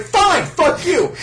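high, loud, slow indignant snap; angry Chopper. Acted. Chopper fed up with the buried dude, high slow snap. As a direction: spit it out in a huff. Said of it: "And Chopper's like".

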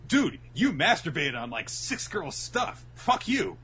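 high, loud sneering bully; mocking bully voice. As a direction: sneer it like a jeering teen. Bullies voiced by teller.

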